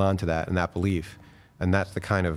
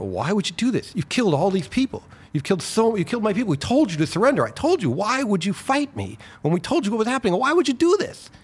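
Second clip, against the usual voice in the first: raised, high furious questioning; angry; 11 semitones higher than usual. Retold or imitated. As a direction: demand answers from the enemy, rising anger. Poppy confronts the German prisoners.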